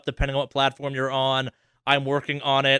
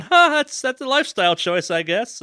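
dry sarcastic judgment; 9 semitones higher, 3 dB louder than usual. Retold or imitated. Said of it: "three dogs is me going".

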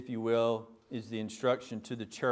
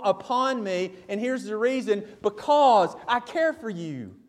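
raised, high, forceful sermon delivery; impassioned preaching; 8 semitones higher, 8 dB louder than usual. Real, not acted. Preacher urging congregation to trust God with all, impassioned. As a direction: preach it hard, pitched up and insistent.

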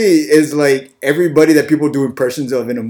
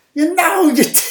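very high parrot impression; Gilbert Gottfried; 13 semitones higher than usual. Co-host trying the Aladdin parrot, high-pitched impression. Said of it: "the parrot in Aladdin".

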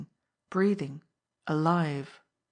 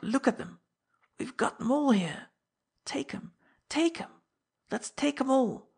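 rising feverish plea; beg, voice climbing higher; urgent whisper; 5 semitones higher than usual. Acted. Old man begs greedily, overexcited.

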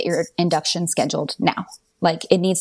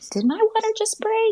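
surprised. Retold or imitated. Water breaking on way to yoga.

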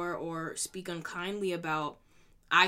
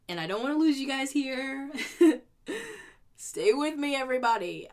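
laughing; 7 semitones higher, 7 dB louder than usual. Real, not acted. Laughs while asking viewers stay.

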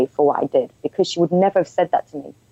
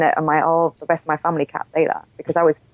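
normal talking voice

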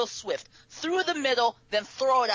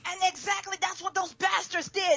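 excited; 8 semitones higher, 4 dB louder than usual. Real, not acted. Excited ranting about bait thieves.

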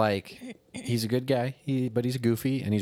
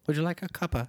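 mock British accent; 5 semitones higher, faster than usual. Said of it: "haven't you seen Call the Midwife".

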